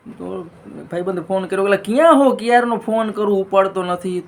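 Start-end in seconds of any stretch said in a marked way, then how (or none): 1.6-4.2 calling out. Acted. Friend calling on phone.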